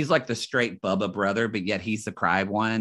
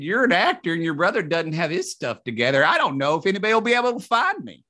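raised, emphatic dad impression; imitating his father; 6 semitones higher, faster than usual. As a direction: do your dad justifying himself, pitch climbing and pace quickening. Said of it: "now I'm doing my father".

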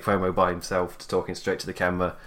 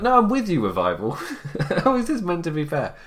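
mock exclaim; 8 semitones higher than usual. Vlogger siding with the Revival, high laughing mock outrage. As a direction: cheer the heels on through a laugh.

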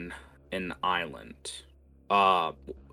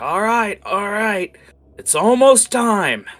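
high, loud pirate call; shouted tidbit; 13 semitones higher, 11 dB louder than usual. Acted. Game master voices pirates heard cheering on a distant ship, shouting. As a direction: holler it out over the water.

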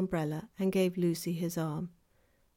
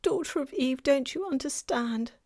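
higher, pleading faun voice; distressed faun; 6 semitones higher than usual. A narrator's reading. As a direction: plead to be understood.

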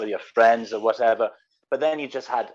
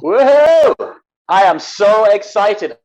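high, loud gleeful whoop; excited cheer; 7 semitones higher, 10 dB louder, slower than usual. Acted. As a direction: burst in like a thrilled contestant. Contestant cheering entrance.

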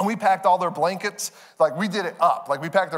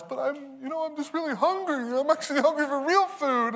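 put-on emotional child; 8 semitones higher than usual. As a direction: plead like a child near tears. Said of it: "so she's emotional".

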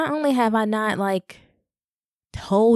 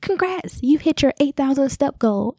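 high, chirpy Fitbit notification voice; congratulatory app message; 7 semitones higher than usual. Retold or imitated. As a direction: announce it like a perky automated alert. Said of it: "I get this message on my Fitbit".

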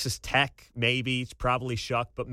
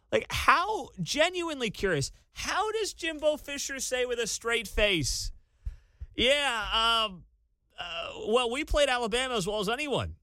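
incredulous; 9 semitones higher than usual. Real, not acted. Mocking Jimbo's straight face.

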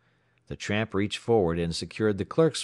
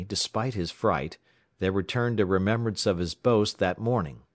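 ordinary delivery

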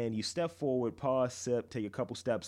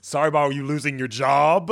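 loud sarcastic apology; mock shout; 3 semitones higher, 11 dB louder than usual. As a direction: yell the fake apology.

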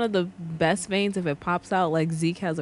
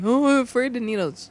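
higher-pitched mock fretting; mock-scared patient; 6 semitones higher, 3 dB louder than usual. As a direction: imitate a patient scared of needles. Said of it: "every single tattooed patient".

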